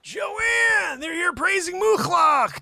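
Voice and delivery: hammed-up scandalized yelling; outraged shout. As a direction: shout for Joanne in mock alarm. Outraged call to Joanne.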